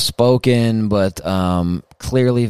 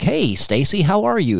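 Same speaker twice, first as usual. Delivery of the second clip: higher-pitched, sing-song mock anchor greeting; news anchor imitation; 7 semitones higher than usual. Host mocking news people greeting Stacy, sing-song anchor voice. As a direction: put on a swooping TV-news hello. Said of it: "people on the news when they'd be like".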